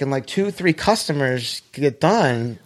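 ordinary talk

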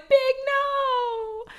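high, wailing mock sob, trailing off soft; fake-sob it like a grieving fan; imitating Carrie's sob over Big, high and wailing; crying. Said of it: "And then I cried like a baby".

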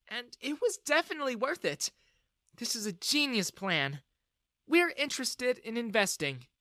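high-pitched female figure voice; encouraging, pleased investor; friendly older lady. A narrator's reading. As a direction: explain kindly, pitched well up. Voices Jessica offering to invest.

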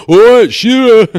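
put-on loud, high drunken greeting; mock shout. Fan greeting Alan Shearer in a bar, shouting. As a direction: holler it across a pub.

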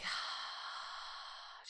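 long, breathy exhale; releasing sigh. Shown as a demonstration. Podcast host shows listener how to release rage, long sigh. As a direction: let a long soft sigh out. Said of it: "just feel yourself process it".

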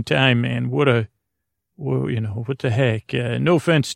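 ordinary delivery

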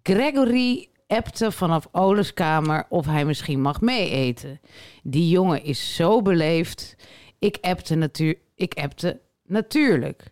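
slow, low, measured read-aloud voice; low-pitched deadpan recital. Acted. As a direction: read the anecdote out slowly and deliberately. Reads a listener's fragment aloud.

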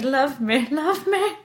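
high pleading love me; needy cat voice. Retold or imitated. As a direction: beg like a nuzzling cat.

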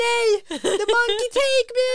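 high, loud, laughing half-cry; mock-dramatic, half laughing; giggly defiant wail. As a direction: kid squealing at mom while fleeing. Child runs from mom.

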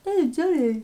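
high, loud Scottish mum voice; Scottish accent. Retold or imitated. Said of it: "Like the Scottish accent".

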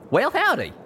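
high-pitched, friendly cowboy voice; cheery greeting. Acted. Pegasus greets the newcomer, cheery. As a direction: swoop up high and greet eagerly.

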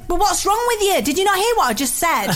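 mock-indignant northern English outburst; loud, brash northern. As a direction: act out a loud teenager badgering a friend. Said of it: "I was being so loud".

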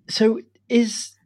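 high, slow questioning auntie voice; lightly mimicked auntie; imitating auntie. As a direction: draw it out up high like a curious aunt. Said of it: "me Auntie Shirley was like".

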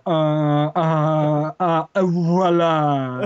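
slow, drawn-out uhs before a light voila; mock his own slow Moray-style French; drawly French hesitation. Imitating himself speaking French to French people, slow and drawly. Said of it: "I'm like".

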